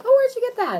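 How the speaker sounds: high, loud amazed question; impressed guest. Retold or imitated. Visitor admiring the house, high and loud. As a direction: marvel at the decor. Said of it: "people come over like".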